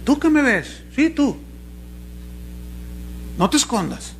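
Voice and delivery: high, pointed call-out to the audience; single someone out like a showy televangelist; mock TV preacher. Preacher mocking a TV evangelist, pointing at a viewer.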